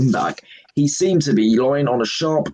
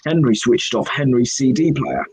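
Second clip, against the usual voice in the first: plain reading voice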